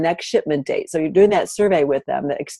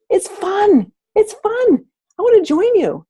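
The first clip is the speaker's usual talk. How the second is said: excited; 5 dB louder, slower than usual. Retold or imitated. Excited acting out guests' delight.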